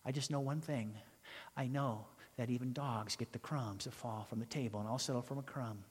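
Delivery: a touch of meek acceptance; humble plea. Retold or imitated. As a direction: quietly settle for a crumb. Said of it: "I think she said".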